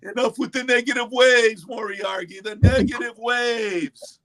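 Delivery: drawn-out stoner movie line; Oddball impression. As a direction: stretch out negative waves, hippie style.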